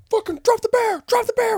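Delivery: very high, loud mock command; frantic, high-pitched imitation of police yelling; retold police shout. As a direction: act out cops yelling an order. Retold police shouting orders at him.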